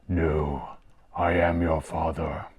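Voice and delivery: a light, deep-set imitation of the dark lord; Darth Vader impression. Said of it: "In English, he says".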